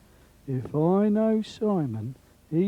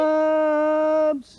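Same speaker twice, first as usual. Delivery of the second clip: high, slow drawn-out street cry; beggar calling out; 11 semitones higher than usual. Acted. Beggar in the street crying out to passers-by, high and slow. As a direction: hawk it to the passing crowd, long and high.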